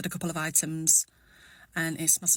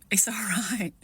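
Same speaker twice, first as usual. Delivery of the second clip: whisper; 4 semitones higher, slower than usual. Real, not acted. Whispered self-reassurance.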